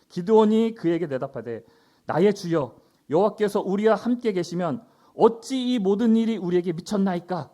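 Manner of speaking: loud, declaimed Bible verse; projected formal reading; scripture reading. Acted. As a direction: read Gideon's protest out with force. Reads scripture aloud.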